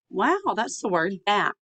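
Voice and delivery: ordinary talk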